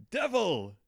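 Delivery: a high, loud cry of rage; Victor, outraged, exclaims at the monster; furious exclamation. Acted. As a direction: hurl the word at the monster. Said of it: "Frankenstein does most of the yelling".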